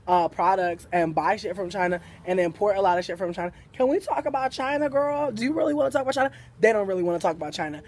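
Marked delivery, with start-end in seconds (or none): 3.7-6.3 higher, fast, daring the customer; high-pitched sassy challenge to a customer. Retold or imitated. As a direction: pitch it up and dare her to answer.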